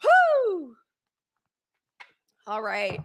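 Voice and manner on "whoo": high, loud joyful whoop; excited whoop. Real, not acted. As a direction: let out a happy cheer.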